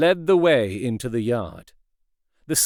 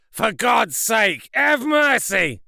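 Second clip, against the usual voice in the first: high, slow, strange pleading voice; desperate plea; 7 semitones higher, slower than usual. A narrator's reading. A hidden voice begging Utterson for mercy, desperate and strange. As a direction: beg for mercy in terror. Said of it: "said the voice".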